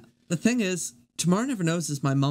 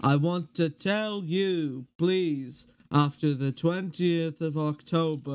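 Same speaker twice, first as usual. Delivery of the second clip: slow, measured drummer impression; deliberate, drawn-out solemn address; memorised video recital; slower than usual. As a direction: deliver it as a ponderous public announcement. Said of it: "I've memorized this".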